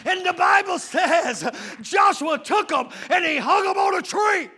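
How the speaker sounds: forceful, rhythmic sermon delivery building to shouts; impassioned preaching. Real, not acted. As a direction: preach hard, driving each phrase home.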